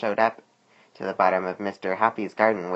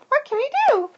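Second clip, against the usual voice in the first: very high, squeaky worm question; Cheryl asking; 5 dB louder than usual. A narrator's reading. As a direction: ask in a tiny, piping worm voice. Cheryl asks her cousin.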